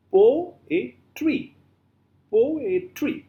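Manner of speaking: "Poetry" broken into po, e, try, high-pitched; syllable by syllable. Shown as a demonstration. Teacher showing three syllables, syllable by syllable. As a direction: count out "Poetry" in three separate beats. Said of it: "So we have three syllables. How?".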